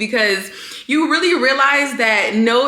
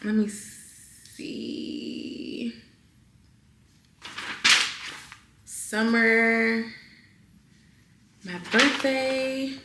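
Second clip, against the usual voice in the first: very soft, slow reading to oneself; absent muttering; 9 dB quieter, much slower than usual. Real, not acted. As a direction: mumble while hunting through the pages. Searching the journal to herself.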